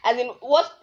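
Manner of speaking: ordinary delivery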